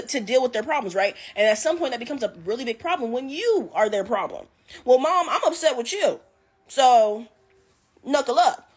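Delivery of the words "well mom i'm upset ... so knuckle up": higher, louder mock challenge from a teen son; mock angry son. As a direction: toss out a cocky dare, half-joking. Boy voiced threatening his mom.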